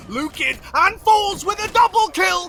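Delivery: high, excited play-by-play; esports caster. Acted. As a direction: ride the double kill up.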